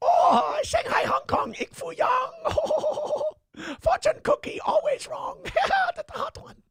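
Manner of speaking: very high, shrieking mock anime voice. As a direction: shriek it like a hammy anime character. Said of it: "in your anime, anime voice".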